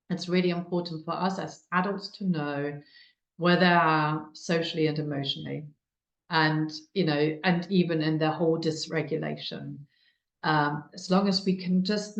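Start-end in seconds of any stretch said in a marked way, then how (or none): none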